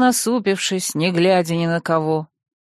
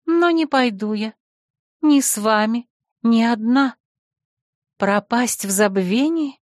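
lightly voiced heroine, higher pitch; politely but firmly decline, voice a little raised; Belosnezhka; 3 semitones higher than usual. A narrator's reading.

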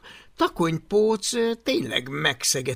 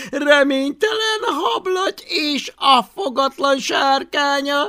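high, loud taunts broken by laughter; laughing hysterically; 11 semitones higher, 8 dB louder than usual. Acted. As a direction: howl with mocking laughter. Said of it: "úgy röhögött". (hisztérikus röhögés közben)

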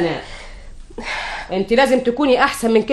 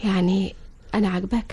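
very soft, lower voice; coy murmur; 4 semitones lower, 10 dB quieter than usual. Acted. Wife asking husband if he likes her, coy. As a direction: ask shyly and softly for a compliment.

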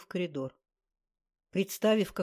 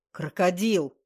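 a louder, cross outburst; angry; 7 dB louder, slower than usual. A narrator's reading. The bitten occultist angrily naming the girl. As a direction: snap it out in irritation. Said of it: "сердито изрёк".

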